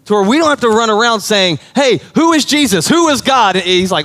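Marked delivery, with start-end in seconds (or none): 1.7-3.6 high, urgent calling out; higher-pitched, insistent mock questioning; clamoring questioners. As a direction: act out people asking everyone around. Acting out people asking around.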